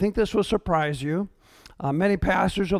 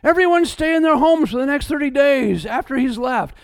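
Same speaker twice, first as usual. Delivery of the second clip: a hint of a panicky mayor, voice pitched up; mocking mayor; 5 semitones higher than usual. Pastor mocks a panicked mayor ordering a lockdown, lightly voiced. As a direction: lightly play the flustered mayor. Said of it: "and the mayor says".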